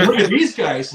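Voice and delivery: laughing. Retold or imitated. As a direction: laugh it out at the sloppy band. Opening band awed by them.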